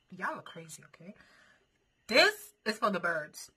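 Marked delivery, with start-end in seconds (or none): 2.1-3.5 loud. Real, not acted. Loud slow verdict on spice.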